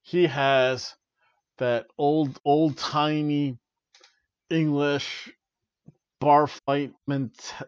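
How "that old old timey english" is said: normal talking voice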